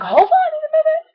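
high, loud strained yell; squeaky, cracking shout at mom; retold scream. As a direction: re-enact yelling through laryngitis. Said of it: "I was screaming at her".

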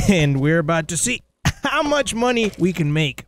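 ordinary talk, nothing marked

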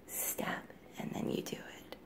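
soft whisper. Real, not acted. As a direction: whisper it gently. Mom whispers demo to toddler.

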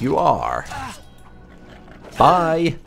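usual speaking voice

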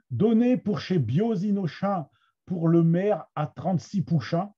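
Picard verse, trente-six pouchins with a "sh" sound; Somme Picard accent. Shown as a demonstration. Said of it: "avec, euh, mon accent de la Somme". (accent picard de la Somme, « pouchins » avec un son ch)

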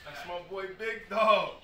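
loud hype-man introduction; hype announcing. Real, not acted. Coach hypes up his star player at the banquet, loud announcing. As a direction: announce him like a champion, loud and proud.

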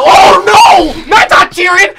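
loud, high-pitched outcry; real high, wailing shout of dismay; shocked shouting. Real, not acted. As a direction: yell it in loud disbelief. Shocked reaction to people cheering.

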